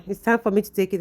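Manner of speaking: ordinary talk, nothing marked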